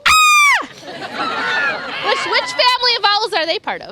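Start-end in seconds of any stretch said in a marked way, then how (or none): 0.1-2.0 very high, loud screeching shriek; barn owl scream. Shown as a demonstration. Showing kids how a barn owl screams. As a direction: let out a piercing owl screech. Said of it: "barn owls go".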